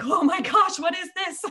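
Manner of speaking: high, loud mock alarm; mock panicked shock. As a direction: yelp it in exaggerated dismay. Retelling panic over R clients.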